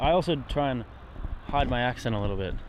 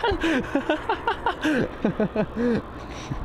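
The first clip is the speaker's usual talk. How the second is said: real high-pitched fit of laughter; long laugh. Real, not acted. Joking about getting demonetized.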